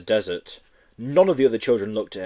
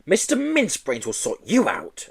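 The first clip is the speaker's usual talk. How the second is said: triumphant; 7 semitones higher than usual. Acted. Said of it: "announced Miss Hatchet triumphantly".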